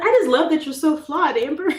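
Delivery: high, loud gushing backhanded praise; mock fan. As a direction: gush a backhanded compliment. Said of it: "It's like".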